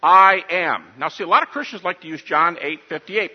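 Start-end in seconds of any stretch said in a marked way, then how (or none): 0.0-0.8 I am declared loud and firm; emphatic I am. Shown as a demonstration. Preacher translating Jesus' answer for the congregation, loud and firm. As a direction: boom out "I am". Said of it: "What does that mean?".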